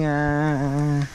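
ordinary talk, nothing marked

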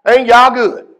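fiery sermon rising in heat; full-force preaching. Acted. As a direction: preach it hard to the congregation.

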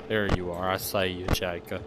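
very soft, hushed taunting; whispering I see you to the robot chicken; creepy hush. Pretend. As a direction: murmur it softly like a creepy game. Spots animatronic in dark.

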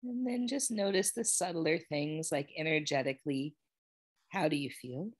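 calm, unhurried guiding voice with long pauses; guided meditation. Real, not acted. Yoga teacher guiding students to notice how they feel, meditative. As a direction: ease them gently into noticing body and breath. Leads a settling body check-in.

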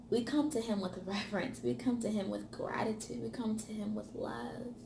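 ordinary talk, nothing marked

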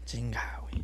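imitating a deflated mutter under his breath; muttered to himself. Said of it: "Y dije".

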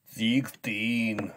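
drawn-out strongman quiz answer. Pretend. Strongman takes the review quiz, answering boldly. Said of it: "Take it away".

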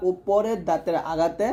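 ordinary talk, nothing marked

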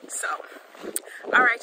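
ordinary talk, nothing marked

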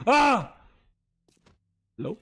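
ordinary delivery

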